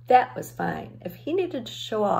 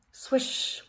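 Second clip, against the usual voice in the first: soft whispered swish; swish sound; 6 semitones higher, 4 dB quieter than usual. A narrator's reading. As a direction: breathe a quiet swoosh as the toy drops. Horse dropped into the bag.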